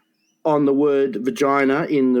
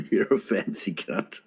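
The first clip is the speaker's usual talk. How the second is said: wheezing with laughter; quiet laughing repeat; 7 semitones lower, 7 dB quieter than usual. Real, not acted. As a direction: say it through breathless laughter. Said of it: "laughing reply".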